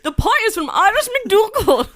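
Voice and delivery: high, loud, hammed-up Irish accent; mock Irish accent. Shown as a demonstration. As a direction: belt out a silly Irish brogue. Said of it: "[name], you do it".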